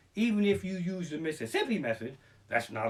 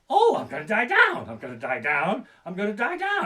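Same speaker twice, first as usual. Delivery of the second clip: mock fear; 4 semitones higher, 5 dB louder than usual. Ferris wheel descent.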